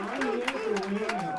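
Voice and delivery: loud, punchy statement of intent; emphatic declaration. Real, not acted. Contestant tells the audience she came to win, loud and emphatic. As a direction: plant your feet and claim the win.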